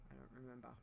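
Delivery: ordinary talk, nothing marked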